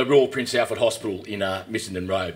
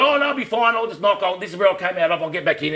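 nervous bluff; 8 semitones higher, 4 dB louder than usual. Retold or imitated. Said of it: "And I said".

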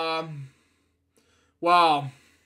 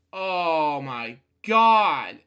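exasperated; 6 dB louder, much slower than usual. Real, not acted. Reader exasperated aside.

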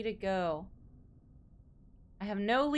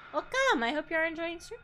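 high bright welcome; excited greeting; 7 semitones higher than usual. Real, not acted. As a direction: sing out the welcome. Greeting a chatter.

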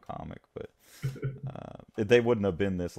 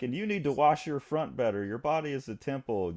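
higher-pitched mock sermon to staff; acted lecturing; 7 semitones higher than usual. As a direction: imitate a fussy boss giving orders. Boss lecturing his dancers.

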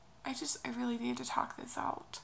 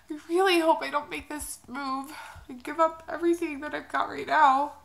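louder, straining through tears; desperate hope, close to crying; tearful plea; 3 semitones higher, 8 dB louder than usual. Real, not acted. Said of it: "another crying video".

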